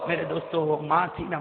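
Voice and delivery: ordinary talk, nothing marked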